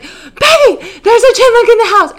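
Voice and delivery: high, loud and rushed alarm; frantic. Retold or imitated. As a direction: blurt it out in a panic, fast. Frantic call to friend Becky.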